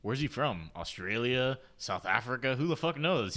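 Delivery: ordinary talk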